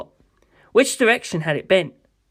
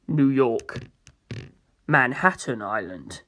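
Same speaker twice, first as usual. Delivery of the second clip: much lower and softer, slow; low slow priest; 6 semitones lower, 5 dB quieter, slower than usual. Acted. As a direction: answer quietly like a grave priest. Pucci answers low.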